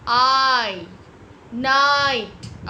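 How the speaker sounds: आई, नाइट loud and held, "aaai, naaait"; long I sound. Shown as a demonstration. Teacher modelling the I sound, long loud आई, नाइट. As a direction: stretch the vowel hard in आई, नाइट. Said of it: "आई का sound".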